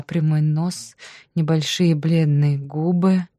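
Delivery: ordinary delivery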